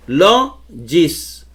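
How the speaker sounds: "Logis" in two slow syllables, stress on "Lo"; penultimate stress. Shown as a demonstration. Teacher showing it holds here too, "Logis" split with stressed LO. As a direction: stretch the LO of "Logis", pause, then "gis". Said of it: "en la penúltima".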